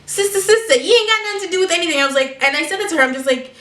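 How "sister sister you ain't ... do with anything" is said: higher, quick retort; retold quick-fire telling-off. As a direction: call her out in a raised pitch.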